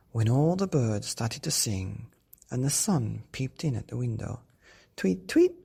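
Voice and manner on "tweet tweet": high loud tweeting; birdsong. Acted. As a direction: chirp like birds. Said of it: "went the birds".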